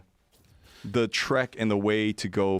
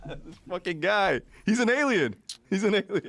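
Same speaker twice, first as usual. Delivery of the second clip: laughing through the words, much higher; cracking up while blurting it out; 10 semitones higher than usual. Real, not acted. Friend cracking up at the alien story, high laughing.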